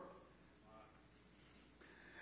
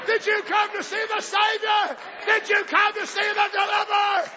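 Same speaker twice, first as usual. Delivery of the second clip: full-force preaching; 8 semitones higher than usual. Acted. Preacher shouting questions at the congregation, full-force preaching. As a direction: drive each question home to the congregation.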